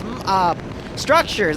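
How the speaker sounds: usual speaking voice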